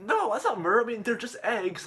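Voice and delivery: high-pitched indignant objection; acted protest. Acted protest at murder charge.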